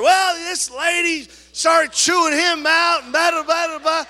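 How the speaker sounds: booming retelling. Retold or imitated. Pastor loudly tells the congregation the story. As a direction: shout it to the whole church.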